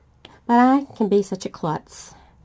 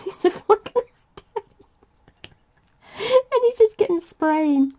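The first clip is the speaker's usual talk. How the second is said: much higher and slow, laughter in the voice; laughing; 12 semitones higher than usual. Real, not acted. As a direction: squeak it out through laughter. Laughing at funny memory.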